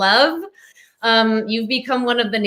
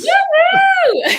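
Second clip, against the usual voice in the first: very high, loud cheering whoop; excited whoop; 5 dB louder than usual. Real, not acted. Host cheers the guest's show title, excited whoop.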